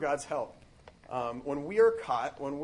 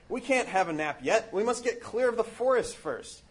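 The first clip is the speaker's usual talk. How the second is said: urgent Frodo; 7 semitones higher, 4 dB louder than usual. A narrator's reading. Frodo urging the others on.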